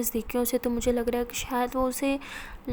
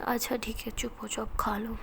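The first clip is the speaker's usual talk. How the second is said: whispered, much softer hush; 3 semitones lower, 7 dB quieter than usual. A narrator's reading. Ishani hushing Pari at dinner, soft whisper. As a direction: hush her and coax her to eat.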